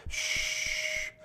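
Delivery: whispered hiss imitating air from a mask. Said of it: "and I hear that".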